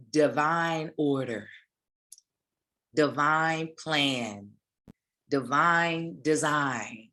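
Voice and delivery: each phrase drawn out slowly; slow emphasis. Acted. Preacher savoring divine order, divine plan, divine design slowly.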